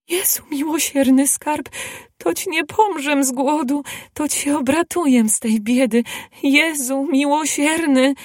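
high, exclaiming voice of a poor woman; okrzyk radości. A narrator's reading. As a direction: cry out high in grateful relief. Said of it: "zakrzyknęła kobieta". (wysoki, radosny okrzyk kobiety)

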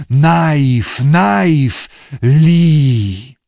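loud barking newt calls; barking creature. A narrator's reading. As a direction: bark the word like an animal. Newts bark at Lee.

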